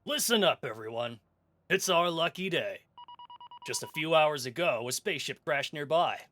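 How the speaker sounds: rally the crew with a loud call; raider captain. Acted. Voices the raider captain briefing.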